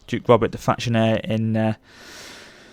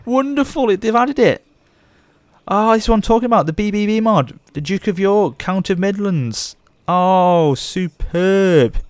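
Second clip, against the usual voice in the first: high-pitched, delighted exclaiming; real glee, voice held way up high; overjoyed; 11 semitones higher, 3 dB louder than usual. Real, not acted. Gamer finding his favourite mod has been added, overjoyed.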